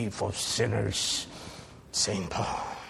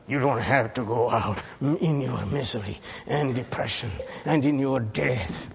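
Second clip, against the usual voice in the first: ordinary delivery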